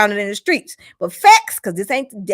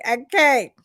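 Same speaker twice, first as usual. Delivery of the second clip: ordinary talk, nothing marked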